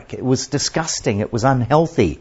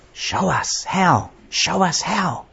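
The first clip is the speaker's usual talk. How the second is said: simple prayer; 5 semitones higher, slower than usual. Acted. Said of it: "was praying".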